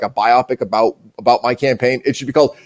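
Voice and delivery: usual speaking voice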